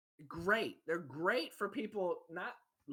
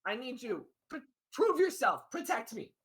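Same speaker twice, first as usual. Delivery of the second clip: pleading; 5 semitones higher, 5 dB louder than usual. Acted. Character pleading for protection.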